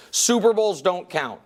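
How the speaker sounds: usual speaking voice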